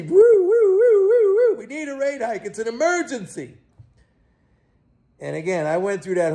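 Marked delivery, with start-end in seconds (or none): 0.0-1.6 high siren whoop; mock siren wail. Mock alarm over emergency hike. 1.6-3.6 high, hushed mock urgency; mock alarm. Mocks Fed emergency announcement.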